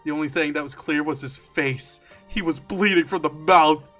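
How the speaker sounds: ordinary delivery, nothing marked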